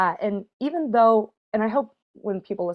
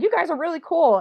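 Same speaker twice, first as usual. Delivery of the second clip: gushing; 10 semitones higher, 3 dB louder than usual. Retold or imitated. Retelling her gushing reaction.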